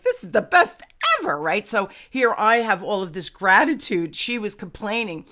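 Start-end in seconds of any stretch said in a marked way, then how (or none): 0.0-1.4 high, loud burst of delight; gleeful enthusiasm. Retold or imitated. Hockey mom relishing watching her kids play, high-pitched elation. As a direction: exclaim it like a thrilled hockey parent.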